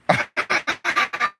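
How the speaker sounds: breathy, voiceless laughter; wheezing burst of laughter; real laugh. Real, not acted. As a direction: laugh helplessly at your own mistake. Laughs at own mistake.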